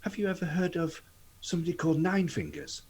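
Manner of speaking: lightly played innocent inquiry, lifted high; probing in-character question. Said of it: "then he wants to say".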